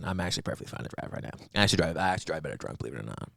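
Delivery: fast, unvoiced drunk mumble; mock drunk mumble. Drunk driver mumbling to the cop, fast and hushed. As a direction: rattle it off under the breath like a tipsy driver.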